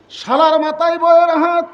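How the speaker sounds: "শালার মাথায় বইয়ের হাত" called out high and loud; loud punchline. Shown as a demonstration. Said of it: "repeated line". ("শালার মাথায় বইয়ের হাত" উঁচু ও জোরে বলা)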